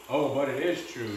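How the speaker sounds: plain reading voice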